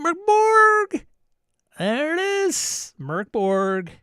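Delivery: high, loud, drawn-out cry; playful hype shout. Pretend. Host hailing a viewer's game pick with a hammed-up shout. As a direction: belt the name out like a hype man. Mock cheering a game's name.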